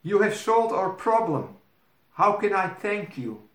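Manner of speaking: high, thrilled fairy-tale princess; cartoon princess. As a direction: gush like a grateful cartoon princess. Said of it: "asked the princess".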